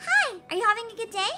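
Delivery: high, fast perky English greeting; perky dub line. Shown as a demonstration. Showing a middle-ground English dub, fast and perky. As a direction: bounce it like a cheerful dub. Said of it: "and then in English it's like".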